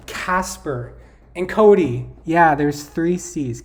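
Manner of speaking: loud, slow and emphatic as the answer appears; real burst of recognition; excited discovery. Real, not acted. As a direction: call out the names you had forgotten. Finds the forgotten city names.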